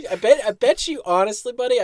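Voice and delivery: fast, laughing talk; teasing through laughter. Real, not acted.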